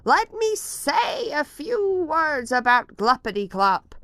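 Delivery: high, loud angry scolding; angry Lorax. A narrator's reading. Lorax angrily scolding the Onceler about the goo. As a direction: tell him off, voice rising. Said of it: "snapped the Lorax, his dander was up".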